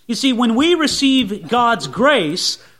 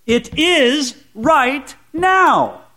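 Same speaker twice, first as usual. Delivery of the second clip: IS, RIGHT and NOW each slow and higher; slow, raised, word-by-word insistence on NOW; emphatic stress; 5 semitones higher, much slower than usual. Real, not acted. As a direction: land on "is", "right", "now" separately, with pauses. Preacher driving home the word is.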